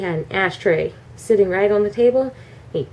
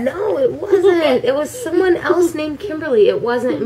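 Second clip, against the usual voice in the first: laughing denial; 6 semitones higher than usual. Real, not acted. Vlogger denying to her teasing friend, laughing. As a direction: deny it through laughter.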